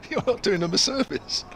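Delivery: high, giggly punchline; laughing. Retold or imitated. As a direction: squeeze the line out between laughs.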